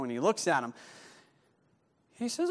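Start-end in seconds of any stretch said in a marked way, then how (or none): none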